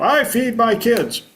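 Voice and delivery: high-pitched parody of self-congratulation; mock boasting. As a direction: brag about the bare minimum, hammed up high. Mocking people boasting of bare minimum.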